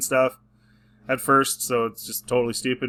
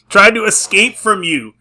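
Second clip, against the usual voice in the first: ordinary talk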